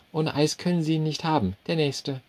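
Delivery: high, brisk waitress brushing him off; curt waitress. A narrator's reading. Waitress waving off the customer, high and curt. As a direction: call the next one in a high voice.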